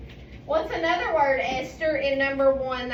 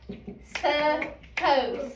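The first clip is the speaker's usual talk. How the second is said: slow, split into su and pose; clapped syllables. Shown as a demonstration. Teacher sounding out a word for the class, slow syllables. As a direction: sound out each syllable slowly. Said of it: "let's clap out the syllables".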